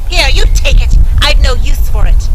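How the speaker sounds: dismissive stepmother. A narrator's reading. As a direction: shove it at her.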